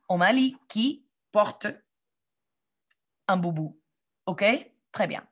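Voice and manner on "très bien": ordinary talk, nothing marked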